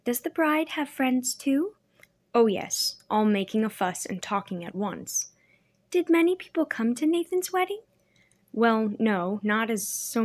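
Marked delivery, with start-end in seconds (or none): 0.0-1.7 put-on young girl voice. A narrator's reading. Girl's voice, narrated dialogue. 5.9-7.8 put-on high, eager little girl; young girl voice. A narrator's reading. Girl's eager question.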